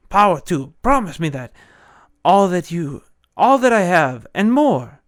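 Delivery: loud, frantic pleading for his life; desperate begging. Acted. Dying villain begs the swordsman, loud and frantic.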